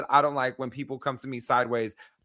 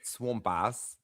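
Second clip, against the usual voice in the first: quiet, lower attempt at an Australian accent; half-hearted Aussie accent, drawn from a request; 4 semitones lower, 9 dB quieter than usual. Shown as a demonstration. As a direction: try out a shaky Aussie accent on two words. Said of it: "Say it in an Aussie accent".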